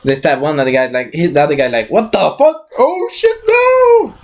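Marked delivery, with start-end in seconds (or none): 1.9-4.1 high squealed horror, climbing to a falsetto cry; hammed-up panicked shriek; mock panic. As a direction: act out a henchman freaking out. Acts stabbed man's companion panicking.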